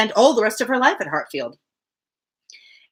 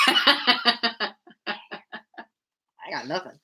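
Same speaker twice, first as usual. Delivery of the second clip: plain reading voice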